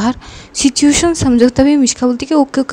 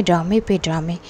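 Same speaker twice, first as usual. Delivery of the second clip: lower, softer repeat of ड्रामे पे ड्रामे, trailing off; ड्रामे पे ड्रामे repeated low and quiet, like a sneering aside; muttered sarcastic repeat; 5 semitones lower, 6 dB quieter than usual. Retold or imitated. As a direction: drop it under your breath as a dry echo. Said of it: "पूजा की mom बोलती कि वाह".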